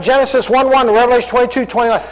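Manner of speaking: ordinary talk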